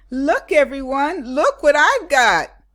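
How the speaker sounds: high, loud, bursting to show off; excited shout. A narrator's reading. Lily calls to her whole class about her purse, loud and excited. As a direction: call out to the whole class, thrilled.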